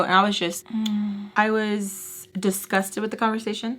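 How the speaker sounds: ordinary talk, nothing marked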